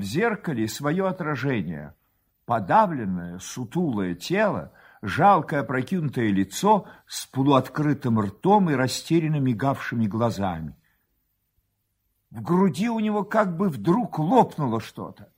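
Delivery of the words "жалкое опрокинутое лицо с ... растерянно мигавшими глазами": usual speaking voice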